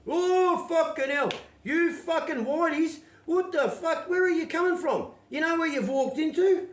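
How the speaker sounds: very high, loud outraged shouting; mock angry locals yelling. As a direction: bellow at the intruders.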